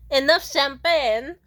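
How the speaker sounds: high, loud, slow mock Israeli accent; mock her Israeli accent, drawn out; Gal Gadot impression. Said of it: "I love how she says it".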